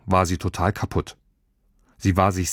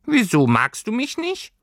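high, hurt little creature voice; a small creature asking if it is unloved; disappointed little fur creature. Acted. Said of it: "Seine Stimme klang enttäuscht".

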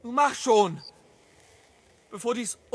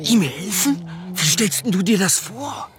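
indignant, louder disbelief; incredulous; 3 semitones lower, 4 dB louder than usual. Acted. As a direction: scoff at the child's idea. Father balks at helping animal.

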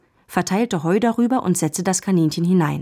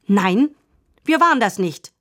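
put-on indignant child's protest, higher pitched; entrüstet; 3 semitones higher, 3 dB louder than usual. A narrator's reading. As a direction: protest your innocence indignantly. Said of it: "sagte Lina entrüstet". (empört protestierend)

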